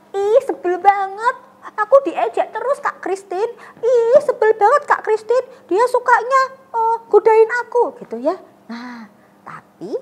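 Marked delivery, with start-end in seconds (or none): 0.1-7.9 higher, louder whining complaint; whiny child. Pretend. Child whines to Kak Christine about being teased. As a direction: complain to the teacher like a sulky kid. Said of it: "ada teman kita yang".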